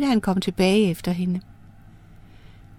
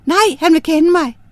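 emphatic refusal; 7 semitones higher, 3 dB louder than usual. Acted. Girl refuses fourth offer.

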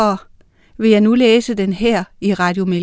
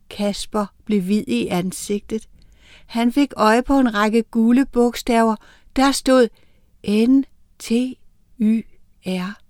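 plain reading voice

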